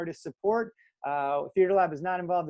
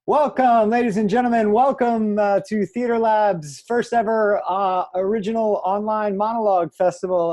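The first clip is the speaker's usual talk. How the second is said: loud, high-pitched emcee opening; announcer's welcome; 4 semitones higher, 9 dB louder than usual. Real, not acted. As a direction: project a big welcome to the whole audience. Host opens the online festival.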